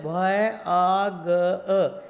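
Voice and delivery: आ ग अ drawn out sound by sound; slow classroom sounding-out; very slow spelling. Shown as a demonstration. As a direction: spell it out very slowly for children. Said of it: "इसमें भ जोड़ेंगे तो क्या है".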